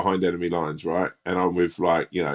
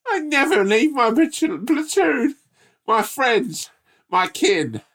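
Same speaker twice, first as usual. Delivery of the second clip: very high, hammed-up noble vow; mocking co-host's voice; 3 dB louder, slower than usual. Mocking his co-host as loyal hero, very high squeak. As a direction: squeak out a heroic oath. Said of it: "You mean".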